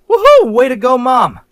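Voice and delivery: loud, high whooping cheer; cheering shout. Real, not acted. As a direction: whoop it up for mom like a fan. Cheering on his mom's prank.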